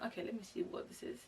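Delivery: soft whispered inner voice; quietly wondering to herself; whispered thought. Retold or imitated. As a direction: breathe it as a private thought. Inner thought answering phone.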